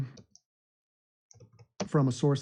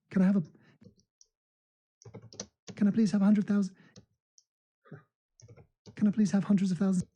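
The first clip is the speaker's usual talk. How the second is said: put-on higher-pitched, quick London-accent plea; 5 semitones higher, faster than usual. Streamer asking for subscribers, put-on London accent. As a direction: beg politely in a London accent.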